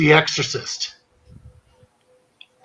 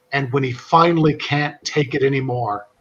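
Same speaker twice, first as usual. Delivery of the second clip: ordinary delivery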